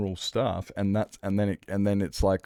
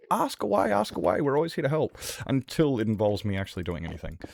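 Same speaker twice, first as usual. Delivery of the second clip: much higher sing-song mock voice; mocking parent; 6 semitones higher than usual. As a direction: sneer at the empty offer of help. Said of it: "mocking parents' offer".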